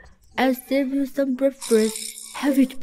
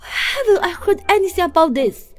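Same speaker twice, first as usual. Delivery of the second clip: higher, louder shock; 4 semitones higher, 5 dB louder than usual. Acted. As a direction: demand it in shock. Shocked protest.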